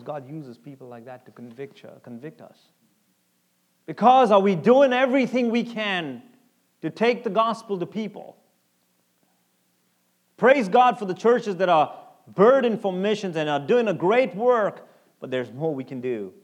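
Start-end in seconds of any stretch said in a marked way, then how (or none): 3.8-14.9 loud, challenging sermon delivery; preaching at full force. Acted. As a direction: challenge the church from the pulpit.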